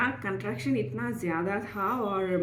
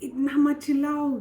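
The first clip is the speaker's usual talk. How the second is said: mildly raised, higher-pitched telling-off; retold scolding; 6 semitones higher, 4 dB louder than usual. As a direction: retell a doctor scolding a noisy patient. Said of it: "उन्होंने बोला". (हल्की डाँट की नकल)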